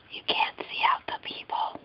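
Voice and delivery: hushed whisper at the peephole; whispering while spying through the peephole. Real, not acted. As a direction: whisper so the neighbors can't hear. Hiding from neighbors.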